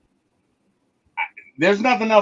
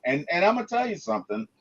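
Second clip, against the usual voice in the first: ordinary delivery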